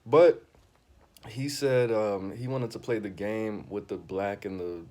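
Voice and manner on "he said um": usual speaking voice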